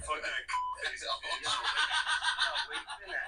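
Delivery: talking through high laughter; words breaking into laughs; real laughter while talking; laughing. Real, not acted. Laughing hard.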